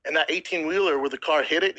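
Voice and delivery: ordinary talk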